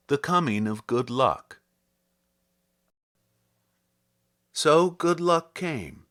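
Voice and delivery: measured, lyrical poetry reading; poem reading. Acted. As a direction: read it like verse for a listener. Reads a Herrick poem aloud.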